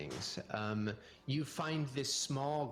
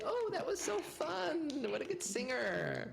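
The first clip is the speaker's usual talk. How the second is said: much higher, delighted passerby voices; cheering crowd. Acted. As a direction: gush like impressed carnival onlookers. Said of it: "They say".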